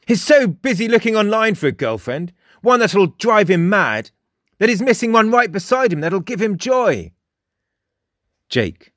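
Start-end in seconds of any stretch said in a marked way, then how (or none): 0.0-7.1 high, emphatic teasing character voice; lively, mocking high voice; amused, mocking Frost. A narrator's reading. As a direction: tease loudly about someone, pitched well up. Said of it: "Frost barked with laughter".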